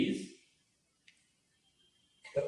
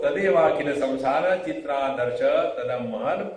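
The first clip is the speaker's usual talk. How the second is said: fast, louder scriptural recital; Sanskrit verse recitation; 3 semitones higher, 4 dB louder, much faster than usual. Acted. Teacher quoting scripture to listeners, brisk recitation.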